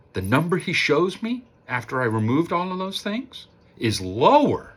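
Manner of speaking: ordinary delivery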